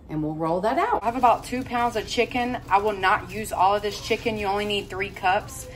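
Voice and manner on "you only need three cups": normal talking voice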